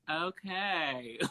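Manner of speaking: drawn-out, underwhelmed okay; unimpressed reaction. Retold or imitated. Friends reacting to her accents, unimpressed. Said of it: "none of them laugh whenever I do their accents".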